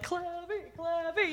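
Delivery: put-on high, soft sing-song kid chant; playful kid. Camper arriving at the front of the line, playful kid voice. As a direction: imitate a boy softly chanting his nickname. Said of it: "then he's like".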